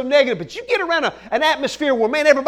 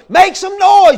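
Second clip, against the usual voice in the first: loud, higher crowd-rousing call; stadium hype shout; 5 semitones higher, 9 dB louder, slower than usual. Retold or imitated. Stadium screen urging fans on, hyped shout.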